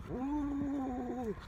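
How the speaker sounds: high happy whoop; excited woo. Real, not acted. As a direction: let out a gleeful cheer. Excited about savings at store.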